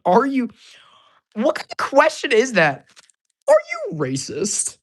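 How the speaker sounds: loud, high indignant disbelief; outraged what-the question. Real, not acted. Reacting to offensive comment.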